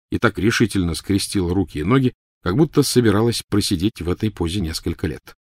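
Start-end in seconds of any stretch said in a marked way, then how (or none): none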